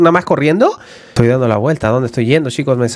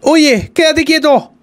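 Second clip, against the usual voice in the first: startled; 12 semitones higher than usual. Real, not acted. Startled by Teletubby Lala.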